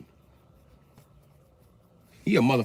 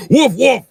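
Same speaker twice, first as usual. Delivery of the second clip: barked woof; 14 semitones higher, 12 dB louder than usual. Shown as a demonstration. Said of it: "Emphasize the F".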